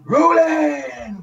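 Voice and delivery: stretched-out high call of rolling; slow, drawn-out set call. Retold or imitated. Director calling rolling on set.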